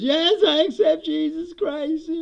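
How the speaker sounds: high, loud, slow mock piety; mock pious acceptance. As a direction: stretch out a hammed-up conversion, high and loud. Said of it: "And he says".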